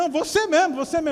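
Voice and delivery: high, loud insistent host; retold insistence. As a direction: imitate someone insisting on singling you out.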